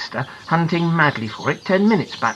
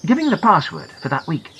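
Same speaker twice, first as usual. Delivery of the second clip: plain reading voice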